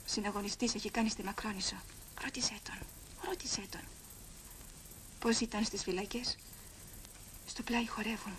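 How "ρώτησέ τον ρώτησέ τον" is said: whispered, Ρώτησέ hushed and urgent. Acted. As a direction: nudge a friend in a whisper. Urging quietly in the crowd.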